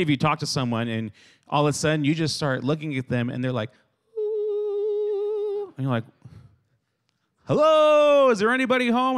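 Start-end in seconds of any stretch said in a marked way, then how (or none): 4.2-5.7 high, held "ooh"; zoned-out high drone; spaced-out "ooh". Acted. Zoned-out friend acted out. 7.4-9.2 loud, high "hello?" to someone zoned out; high-pitched, exaggerated holler; mock calling out.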